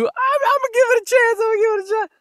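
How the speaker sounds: very high put-on squeaky voice; hammed-up self-quote. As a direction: quote yourself in a silly high falsetto. Quoting own earlier promise, hammed up.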